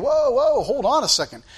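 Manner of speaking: high jump on whoa, then settling back; mock startled whoa. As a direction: rein in an eager visitor, half amused. Preacher acts out his own reaction.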